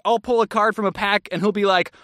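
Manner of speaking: usual speaking voice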